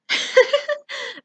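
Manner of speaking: high-pitched giggle. Acted. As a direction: giggle behind a hand. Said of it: "Mimi giggled".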